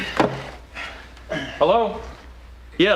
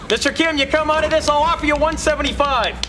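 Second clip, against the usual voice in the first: urgent pleading; 10 semitones higher than usual. Acted. Pleading with collapsing patient.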